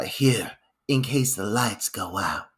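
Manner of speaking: low, hushed ominous delivery; mock ominous. Evelyn handing over a candle, heavy-handed mock ominous. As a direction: imitate her ominous warning, low and soft. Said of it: "with this very ominous tone".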